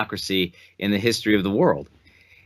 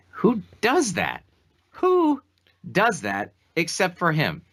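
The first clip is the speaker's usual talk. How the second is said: incredulous; 8 semitones higher, slower than usual. Real, not acted. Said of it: "outraged rhetorical question".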